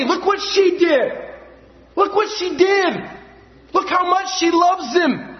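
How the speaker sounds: high and slow, each word stretched with wonder; awed, slow preaching. Real, not acted. Preacher marvels at Mary's gift, slow awed delivery. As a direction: linger on it in awe.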